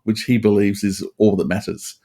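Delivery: ordinary delivery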